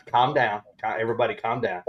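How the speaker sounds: ordinary delivery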